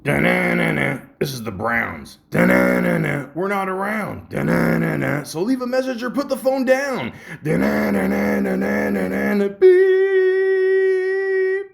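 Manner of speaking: high, loud sung-along answering message; reenacted silly voicemail. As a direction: hum the jingle high and goofy between lines. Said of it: "So I'm gonna try and reenact it".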